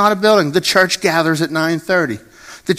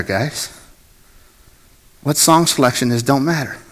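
usual speaking voice

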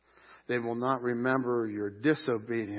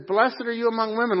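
higher, louder exclaimed blessing; excited praise; 8 semitones higher, 5 dB louder than usual. Retold or imitated. Preacher quotes one expectant mother blessing another, excited praise.

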